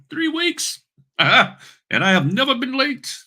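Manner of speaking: very high, preposterously proud British voice; hammed-up British boast, squeaking upward; fake British accent. Three-week notary boasts of punctuality, high fake British accent. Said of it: "my little fake British accent".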